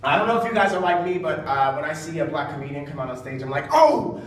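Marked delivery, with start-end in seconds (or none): 3.7-4.2 loud, high hype, last words drawn out; hyped-up audience reaction. Acted. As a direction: whoop it up like a thrilled spectator. Mimics his excited audience reaction.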